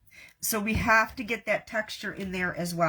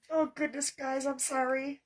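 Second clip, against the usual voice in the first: higher, softened choked-up apology; tearful; 4 semitones higher, 4 dB quieter than usual. Real, not acted. Crafter apologizing to viewers for crying, tearful. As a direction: apologize while fighting real tears. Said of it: "I'm like a blubbering idiot".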